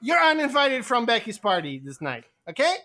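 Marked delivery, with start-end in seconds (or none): 0.0-0.8 high, loud indignant insults; slapped girl shrieking insults; outraged shriek. Acted. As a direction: scream back after being slapped. Slapped character shrieks insults back.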